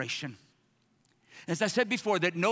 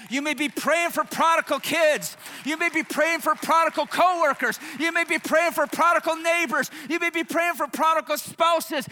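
louder, higher, punching each list item; preaching at full force; 7 semitones higher, 6 dB louder than usual. Acted. Pastor urging his church to celebrate, preaching hard. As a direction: drive the list home like a preacher.

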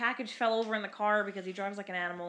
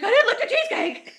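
very high, very loud squawk of innocence; mock outraged yell; 14 semitones higher, 11 dB louder than usual. Accused dad protesting to the caller, mock outraged yell. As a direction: burst out in hammed-up denial, on the edge of laughing. Acting out his yelled denial.

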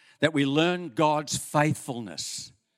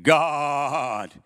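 booming priest; 3 dB louder, much slower than usual. Acted. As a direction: boom it through a cathedral. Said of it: "he boomed in the cathedral".

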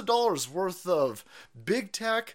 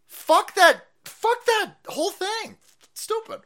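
a much higher, louder shrieking mock voice; mock shrill outrage; 7 dB louder, slower than usual. Mocking an offended critic, shrieking high.

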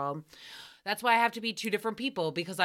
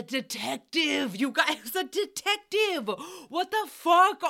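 laughing disbelief; 5 semitones higher than usual. Real, not acted. Host revealing a detective called, building laughing disbelief. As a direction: break into laughter at the absurd reveal.

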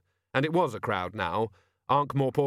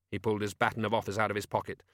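plain reading voice

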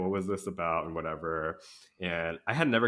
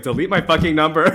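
loud and laughing, much higher; laughing loud brush-off; 9 semitones higher, 14 dB louder than usual. Retold or imitated. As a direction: fire it off through a laugh. Retold blunt brush-off to ex-friend.

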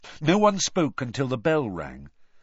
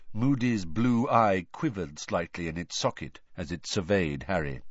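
usual speaking voice

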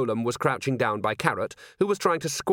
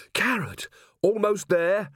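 calling out; 9 semitones higher, slower than usual. A narrator's reading. Calls between fighters, high above.

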